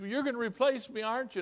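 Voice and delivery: imitated high, anxious pleading; tearful secretary. Crying secretary presses the new pastor, imitated tearful worry. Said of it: "She starts crying".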